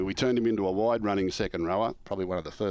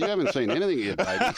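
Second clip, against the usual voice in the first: high, louder, cheeky and laughing; laughing comeback; 11 semitones higher, 5 dB louder than usual. Retold or imitated. Coach teasing the player, laughing. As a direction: tease through a laugh.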